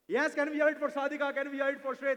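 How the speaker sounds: loud, high rallying call to the crowd; calling for applause. Real, not acted. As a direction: pump up the audience for a big cheer.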